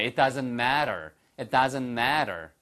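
American "matter" with a tapped t; American accent. Shown as a demonstration. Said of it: "한번 미국식으로 한번 해보실까요". (미국식 영어 발음)

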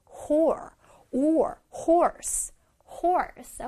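"horse" with the or sound brought out; stress on OR. Shown as a demonstration. Teacher showing children "horse" with an emphasized or. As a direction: isolate the or, then say "horse". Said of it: "O R를 좀 강조해서".

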